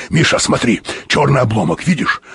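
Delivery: ordinary delivery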